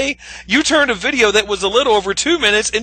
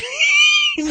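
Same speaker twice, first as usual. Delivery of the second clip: sharp, high burst of laughing; real laughter; 4 dB quieter than usual. Real, not acted. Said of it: "I can't help but actually laugh uncontrollably".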